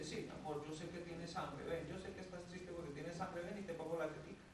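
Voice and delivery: put-on soft, hushed comfort to a crying baby; a mother cooing quietly to her hungry baby; softly soothing her hungry newborn; tender mother.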